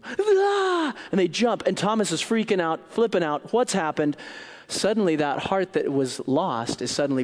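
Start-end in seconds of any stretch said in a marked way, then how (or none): 0.0-0.9 startled scream. Acted. Disciples startled by Jesus.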